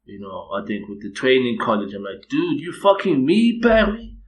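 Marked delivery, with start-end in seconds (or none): 2.3-4.1 high, loud incredulous shout; hammed-up outrage, pitch leaping right up; acted outraged disbelief. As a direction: shout it as a drawn-out, disbelieving question.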